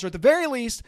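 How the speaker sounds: usual speaking voice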